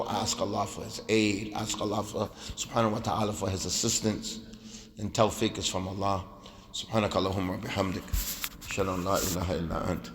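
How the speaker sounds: low, soft supplication; closing prayer. Real, not acted. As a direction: wind down into a murmured du'a.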